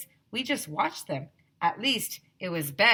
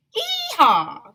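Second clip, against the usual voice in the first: high whooping holler, much louder; shouted; 10 dB louder than usual. A narrator's reading. Teachers whooping as they rush out to dig. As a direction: whoop like a cowboy. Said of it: "they were all shouting".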